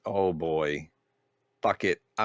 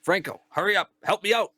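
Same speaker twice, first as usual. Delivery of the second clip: high, strained plea to hurry; urgent call; 12 semitones higher than usual. Acted. Player begs Franco for help, urgent call. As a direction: call out urgently, climbing higher.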